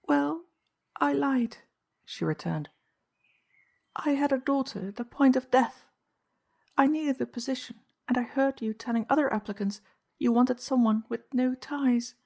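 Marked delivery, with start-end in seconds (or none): 0.1-1.6 lightly voiced anxious housekeeper, higher; a higher woman's voice, nervous; Mrs Kinney. A narrator's reading. 3.9-12.1 higher, pleading woman's voice; anxious housekeeper. A narrator's reading. Mrs Kinney confesses.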